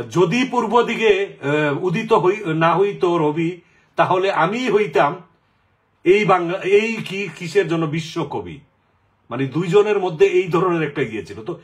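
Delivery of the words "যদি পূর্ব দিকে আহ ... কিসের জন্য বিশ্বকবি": slow, lifted poetic recital; verse recitation. Retold or imitated. Quoting a famous rhyme to the listener, recited slowly. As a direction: declaim the couplet slowly like a poem.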